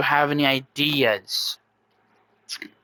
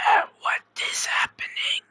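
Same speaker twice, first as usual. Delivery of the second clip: whispery miniature snake voice; voiceless, breath-only creature voice; little snake head; 4 dB quieter than usual. Acted. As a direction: whisper it as a tiny talking snake. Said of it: "Kayla's tail, which has a little miniature snake head".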